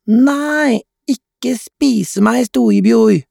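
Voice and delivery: put-on very high, drawn-out child voice; squealing little girl. A narrator's reading. Mia begs pretend-monster brother, slow high squeal. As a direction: plead in a high playful squeal.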